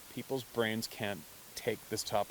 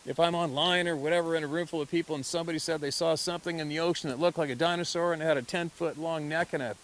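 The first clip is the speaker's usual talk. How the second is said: ordinary talk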